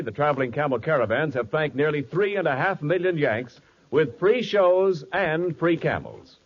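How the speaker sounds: ordinary talk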